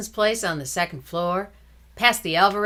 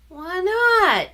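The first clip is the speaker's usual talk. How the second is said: put-on high, louder startled little boy asking; boy asks why his sister won't come, high and louder; surprised little boy; 12 semitones higher, 6 dB louder than usual. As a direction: kid brother can't believe it.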